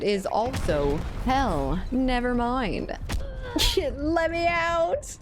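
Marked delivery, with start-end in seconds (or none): 3.0-3.9 a very high burst of laughter; high squealing laugh. Real, not acted. Laughing in the match, very high squeal. 4.0-5.2 much higher, slow drawn-out cry; mock trapped wail. Stuck at the respawn beacon, hammed-up slow high wail.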